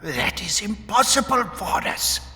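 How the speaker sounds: high, thin-voiced alarm; panicked protest. A narrator's reading. Magric faces an impossible deadline from Vorus, panicked protest. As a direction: object in a high, frightened voice. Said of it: "Magric panicked".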